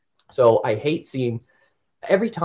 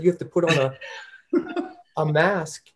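laughing; 6 semitones higher, slower than usual. Real, not acted. Laughing while recounting mask order.

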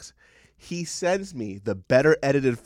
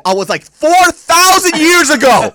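shrieking imitation of mom; 10 semitones higher, 12 dB louder than usual. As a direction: screech mom's words back in a shrill cartoon voice. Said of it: "She goes".

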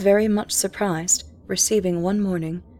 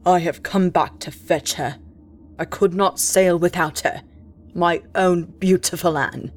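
impassioned captain; 3 semitones lower, 3 dB louder than usual. Acted.

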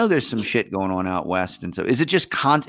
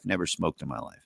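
ordinary talk

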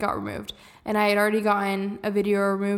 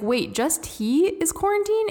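high, slow incredulous question; puzzled disbelief; 8 semitones higher than usual. Retold or imitated. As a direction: stretch out the question, rising in disbelief. Retold puzzled question on the phone.

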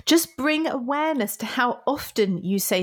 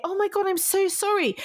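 high, gushing "Oh my God, I'm so sorry"; flustered over-apology; 11 semitones higher than usual. Retold or imitated. Gushing over-apology to a colleague who spotted an error. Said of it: "Normally you would say".

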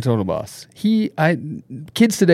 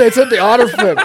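laughing protest; 9 semitones higher, 4 dB louder than usual. Real, not acted.

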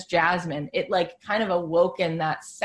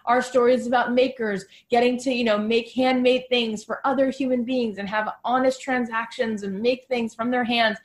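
a lightly voiced, earnest company spokesperson, a bit higher; voicing Etsy's pitch; 5 semitones higher than usual. Podcast host voicing the Etsy executive's pitch to listeners, lightly earnest and a touch higher. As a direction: speak for Etsy as if pitching its mission, proud and sincere. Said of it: "They wanna sell their story of".

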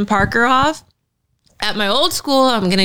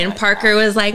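ordinary talk, nothing marked

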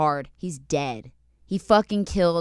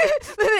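laugh. Real, not acted. Laughing at own impression.

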